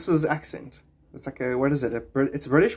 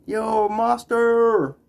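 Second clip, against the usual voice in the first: drawn-out greeting; 6 semitones higher, 4 dB louder, much slower than usual. Real, not acted. Greeting a viewer.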